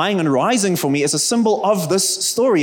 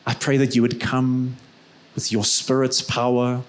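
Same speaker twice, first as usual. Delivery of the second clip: closing prayer; 2 semitones lower than usual. Real, not acted. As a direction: pray gently over the congregation.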